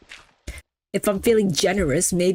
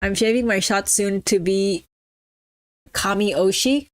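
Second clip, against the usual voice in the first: ordinary delivery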